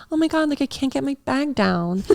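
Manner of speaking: high, breathy-free ditzy flirt voice, pitched well up; mock helpless flirt. Imitating the cheerleader asking for help with her bag: high ditzy flirt. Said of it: "She was like".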